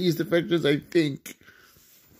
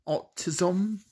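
British accent; 4 semitones higher, 6 dB quieter than usual. Real, not acted. Player reading out an answer, slight British accent. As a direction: say it lightly British. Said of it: "Sorry if I said the British accent".

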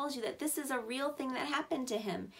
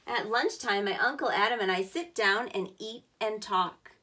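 louder, clear storybook reading; reading to children; 5 dB louder than usual. Acted. Teacher reading the boy's workday to kids, louder storybook voice. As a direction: give every step of the workday a clear read. Reads picture book aloud.

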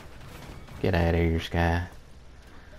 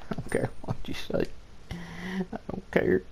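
whispered grumble; 9 semitones higher, 8 dB quieter than usual. Real, not acted.

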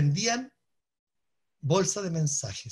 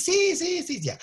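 put-on much higher children calling yes; imitating kids answering; eager kids; 12 semitones higher, slower than usual. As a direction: chirp it like excited schoolkids. Kids answering in classroom roleplay.

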